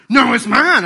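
put-on loud, high toddler it's mine, all worked up; mock toddler tantrum shout. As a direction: screech it like a furious toddler. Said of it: "they get all worked up".

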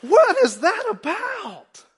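high, loud, slow disbelief; incredulous. Acted. Preacher asking the church in loud disbelief. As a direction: stretch out the disbelief.

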